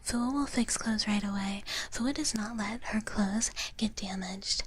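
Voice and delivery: ordinary talk, nothing marked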